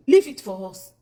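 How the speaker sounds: loud firm demand. Real, not acted. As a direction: bark it protectively.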